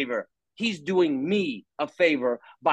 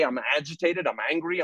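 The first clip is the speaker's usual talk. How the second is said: ordinary talk, nothing marked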